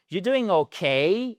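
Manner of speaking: 'okay' slow, rising on a long 'kay'; high, stretched question ending on 'okay'; stress on final syllable. Shown as a demonstration. Teacher modelling an English question, stressed rising 'kay'. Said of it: "un accent tonique sur le K".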